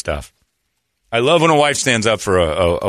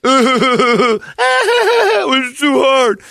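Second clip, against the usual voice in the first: whiny husband impression; 6 dB louder, much slower than usual. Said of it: "a wife who's seen her husband go through this".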